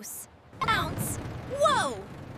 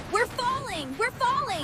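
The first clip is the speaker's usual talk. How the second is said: normal talking voice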